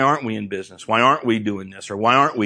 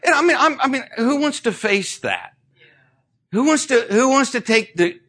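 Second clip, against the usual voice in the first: pleading pitch; 12 semitones higher than usual. Real, not acted. Preacher pleading with the congregation.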